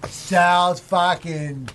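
slow, drawn-out Boston sports fan accent. Shown as a demonstration. Showing how a Boston fan talks Sox, drawn-out Boston accent. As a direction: stretch each team name in broad Boston. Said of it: "Show him how to do a Boston accent".

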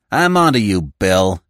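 accusing. Acted. Coutts accuses Smith.

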